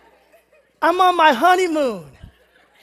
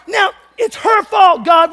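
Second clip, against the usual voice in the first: higher, louder mock outburst; panicked blame; 6 semitones higher, 7 dB louder than usual. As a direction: cry out that it's all her fault. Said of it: "I'm like".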